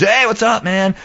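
high, breezy mock greeting. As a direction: pitch it way up as a cheery hello. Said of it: "here comes Grohl".

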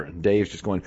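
ordinary talk, nothing marked

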